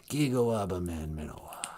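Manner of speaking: creepy farewell, fading very soft; sinister low-volume goodbye in a put-on rasp; Alec Baldwin. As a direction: let the menace die away quietly. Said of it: "Creepy Alec Baldwin".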